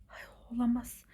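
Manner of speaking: ordinary delivery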